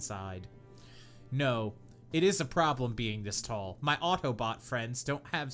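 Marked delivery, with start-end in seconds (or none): none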